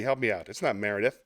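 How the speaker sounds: ordinary talk, nothing marked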